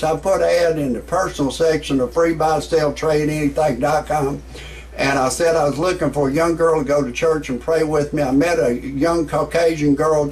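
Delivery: usual speaking voice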